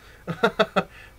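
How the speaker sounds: high, loud burst of laughter; short real chuckle. Real, not acted. Laughs after "that was fun.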